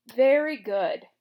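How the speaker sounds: loud, slow, smug "Very good"; satisfied king. A narrator's reading. Gnome King gloating to the Scarecrow, loud and satisfied. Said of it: "exclaimed in a tone of satisfaction".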